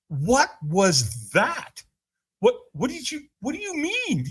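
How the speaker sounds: high-pitched mock bafflement; bewildered. Man reacts to the vanished stranger, acted bewilderment. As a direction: stretch it out, then stammer in disbelief.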